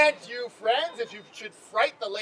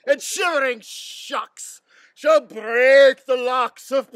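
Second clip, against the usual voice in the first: slow, booming tyrant rant; 3 dB louder, slower than usual. Acted. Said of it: "my chief humor is for tyrant".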